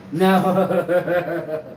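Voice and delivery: loud laughing "no". Real, not acted. Talking to the listener, laughing out loud. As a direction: break into laughter on the word.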